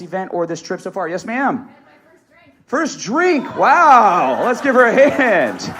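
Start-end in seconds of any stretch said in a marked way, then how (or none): 2.7-5.7 loud, high whoop for a volunteer; hyped-up cheering. Real, not acted. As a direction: call out big applause. Hyping applause for audience member.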